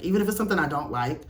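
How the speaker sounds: ordinary delivery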